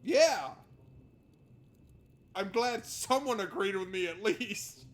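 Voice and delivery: loud vindicated yell; gleeful told-you-so; triumphant shout. Real, not acted. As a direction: cheer being agreed with. Triumphant agreement.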